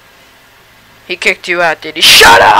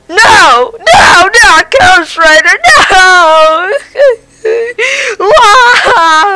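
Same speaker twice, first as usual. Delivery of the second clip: crying out; 10 semitones higher, 6 dB louder, much slower than usual. Acted. Ghost Rider snapped away too.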